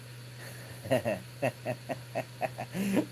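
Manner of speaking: long run of soft giggles; giggling. Real, not acted. Vlogger tickled about a viewer and his better half, giggling. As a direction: giggle helplessly to yourself. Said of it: "Sorry, I'm, I'm giggling".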